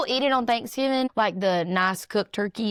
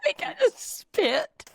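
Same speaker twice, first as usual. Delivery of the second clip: laughing; 14 semitones higher, much slower than usual. Real, not acted. Laughing fit after joke.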